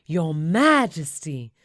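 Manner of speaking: slow, drawn-out reverent cry; a lightly voiced crowd bowing to the king. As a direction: hail the king, slowly. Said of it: "everyone cries".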